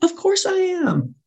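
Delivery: very high, drawn-out mock assurance; overeager assent. New speaker placating her screaming hirer, overeager. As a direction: squeak out an overeager yes, slowly.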